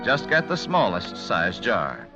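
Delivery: ordinary talk, nothing marked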